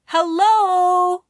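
high, loud friendly call. Acted. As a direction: call hello down from above. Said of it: "Rhea called".

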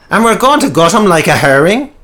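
loud, high, aggressive outburst; snarling skinhead menace; violent threat. Acted. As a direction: threaten him like an angry thug. Skinhead Simon threatens with knife.

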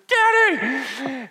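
high, loud call for Daddy; scared child calling. Retold or imitated. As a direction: cry out like a frightened toddler. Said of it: "this very terrified voice".

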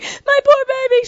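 high, slow mock sob; retold bawling. Wife crying about the dog on the phone, mock sobbing. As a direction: wail it over the baby. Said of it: "I'm bawling".

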